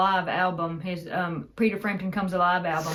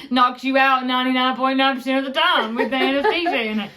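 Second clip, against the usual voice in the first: raised, loud imitation of granddad; granddad impression; 5 semitones higher, 6 dB louder than usual. Joking about granddad's anesthesia soap, granddad impression. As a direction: belt it out like granddad pitching soap. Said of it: "but he's like".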